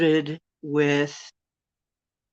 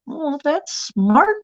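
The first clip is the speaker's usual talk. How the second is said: sarcastic; 11 semitones higher, 4 dB louder, slower than usual. Real, not acted. Sarcastic reaction to comment.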